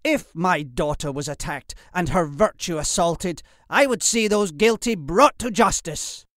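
loud, high, rising indignation; outraged accusation. Acted. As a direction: build to a righteous shout. Lord berates the father as coward.

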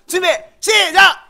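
loud, high, drawn-out start call. Real, not acted. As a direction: call the start like a race official. Starting the shouting contest.

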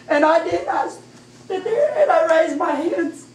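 high, swelling, overwhelmed testimony; overcome with emotion. Real, not acted. Woman testifying the Holy Ghost came, real overwhelmed emotion. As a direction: let the voice rise as feeling takes over.

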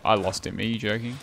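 ordinary delivery

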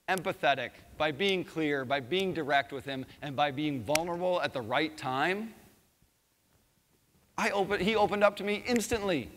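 ordinary delivery

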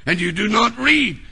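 usual speaking voice

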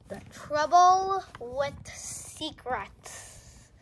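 slow, loud title announcement; announcing title. Acted. Kid reading to her viewers, slow loud title. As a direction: stretch out the title like a big reveal. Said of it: "looks like, uh, my bear wants to read".

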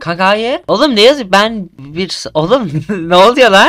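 high, loud, laughing through the words; laughing disbelief. Real, not acted. Reading the friend's odd chat, laughing in disbelief.

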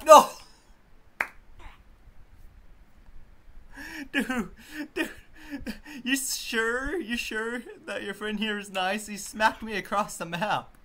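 high, slow incredulous complaint; high excited disbelief. Real, not acted. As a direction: ask in amazed disbelief. Complaining after being smacked.

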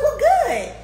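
high, loud, laughing cheer; retold delight. Retelling her delighted reply to friend.

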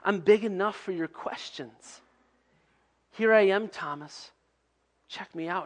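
soft, gentle reassurance; Jesus softly reassuring doubting Thomas; tender Jesus. Pretend. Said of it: "But to say".

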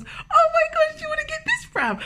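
very high, loud gushing; squealing over a pram; giddy excitement. Retold or imitated. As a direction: gush like a kid at Christmas. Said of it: "the only thing that would get me happy was".

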